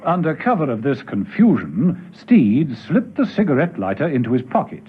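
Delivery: usual speaking voice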